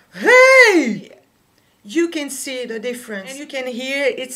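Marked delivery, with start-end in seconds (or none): 0.1-1.1 high, loud call with a warm, round tone; toned high note. Shown as a demonstration. Teacher demos a warm, toned high note. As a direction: open the throat and ring the note out. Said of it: "if we put our heaviest tone on it".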